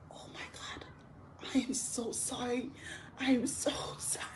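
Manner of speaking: hushed, mortified whisper; embarrassed whisper. Retold or imitated. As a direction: whisper the apology in shame. Waking up, embarrassed apology.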